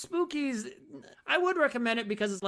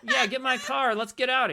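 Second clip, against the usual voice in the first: hammed-up yell; 5 semitones higher, 5 dB louder than usual. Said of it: "playing the scene".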